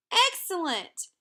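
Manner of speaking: high, loud delighted cry; child thrilled by snow, high and loud; excited cry. A narrator's reading. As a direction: shout it with glee. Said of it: "cried Lena".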